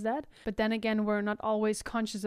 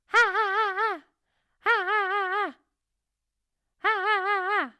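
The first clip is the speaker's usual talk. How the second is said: ha, ha, ha pushed much higher and louder; teacher drills the higher pitch in head voice; 10 semitones higher, 6 dB louder than usual. Shown as a demonstration. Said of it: "to get to the higher pitch".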